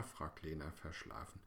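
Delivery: usual speaking voice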